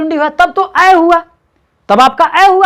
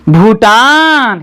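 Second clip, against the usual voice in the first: slow syllable-by-syllable Bhutan; drawn-out reading; much slower than usual. Shown as a demonstration. Teacher to child, reading Bhutan slowly. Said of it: "ये क्या हो जाएगा".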